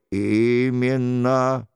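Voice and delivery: low, slow, solemn reproach; a solemn accuser from the dark, drawn out; stern mysterious voice. Acted.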